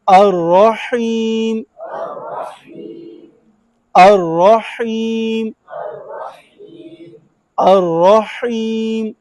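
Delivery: drawn-out chanted Quran recitation; slow recitation. Shown as a demonstration. Teacher leads class in slow chanted recitation. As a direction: chant each word slowly, leaning on और. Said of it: "teacher chants for class to repeat".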